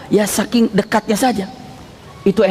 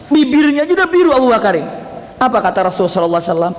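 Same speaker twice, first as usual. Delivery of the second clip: ordinary delivery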